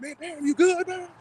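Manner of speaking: high-pitched voice of worried friends; concerned party guests. Retold or imitated. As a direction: imitate friends checking on someone who just fell. Voicing worried party guests after fall.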